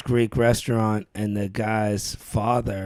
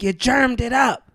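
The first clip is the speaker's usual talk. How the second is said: high, loud cranky complaint; grumpy old lady; 12 semitones higher, 4 dB louder than usual. Retold or imitated. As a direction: scold like an offended old woman. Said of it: "She's like".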